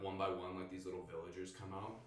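normal talking voice

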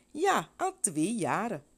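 put-on a girl proudly telling; earnest little girl. A narrator's reading. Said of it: "het meisje zei". (trots kind, langzaam en hoger)

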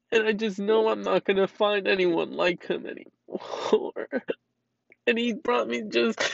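smiling through the crying at the memories; laughing through tears. Real, not acted. Owner remembers her cute kitty, laughing through tears.

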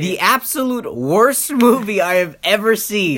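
emphatic rant. Real, not acted. Ranting about the movie.

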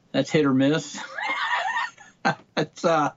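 laughing as he says it. Real, not acted. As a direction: break into a laugh on the words. Laughs at his own remark.

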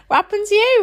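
a stranger asking an adult in nappies, high and mock-puzzled; mock concerned asker. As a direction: play a baffled passer-by asking a stranger. Mock question to nappy-wearing adult.

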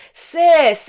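high, loud mocking "sis"; mocking imitation. Imitates the man's reply.

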